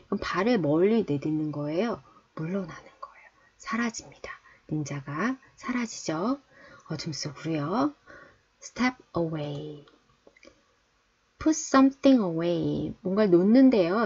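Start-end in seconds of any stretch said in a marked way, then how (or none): 3.6-7.9 hushed secretive whisper; whispering playfully. Pretend. Ninja vanishing, whispered. 8.6-9.9 very slow, each word drawn out; slow teacher demo; slow model reading. Shown as a demonstration. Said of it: "teacher models phrase slowly". 11.4-12.9 very slow, each word drawn out; slow teacher demo; slow model reading. Shown as a demonstration. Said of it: "teacher models phrase slowly".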